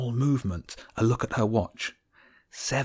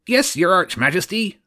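higher, eager servant voice; Groat the guard; 9 semitones higher, 6 dB louder than usual. A narrator's reading. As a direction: answer your master, higher and keen. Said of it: "Groat was the voice on patrol".

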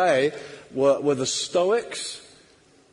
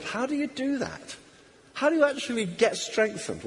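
usual speaking voice

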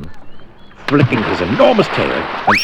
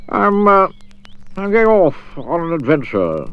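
lightly raised, cheery adventurer; a bright eager guide, a touch higher; Mister Daydream voice; 7 semitones higher, 3 dB louder than usual. Acted. Mister Daydream inviting Jack along, cheery and raised.

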